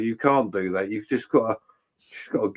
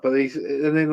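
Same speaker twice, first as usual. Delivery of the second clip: ordinary delivery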